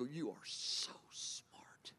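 breathy whisper, slow and intimate. Shown as a demonstration. Preacher whispering praise to God in the night. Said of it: "I go".

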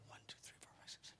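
counting under the breath in a whisper. Retold or imitated. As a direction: whisper the numbers as if counting along silently. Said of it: "seven thousand people going".

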